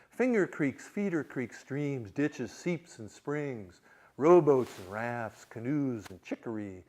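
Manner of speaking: slow, measured literary reading; reading aloud. Acted. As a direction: let the river's list flow slowly. Reads novel passage, river speaking.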